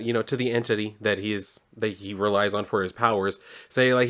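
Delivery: ordinary talk, nothing marked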